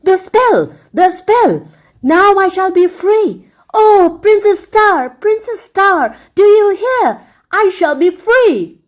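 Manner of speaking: an overjoyed egg crying out to his princess; wild shout. A narrator's reading. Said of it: "shouted wildly".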